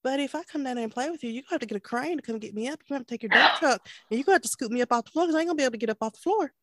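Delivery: rattled off fast; rushed exasperated reply. Retold or imitated. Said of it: "That's like".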